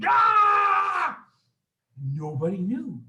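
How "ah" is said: very high, loud, sustained scream; mean mom scream. Acted. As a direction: scream like a permanently furious mom. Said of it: "this is what she looked like".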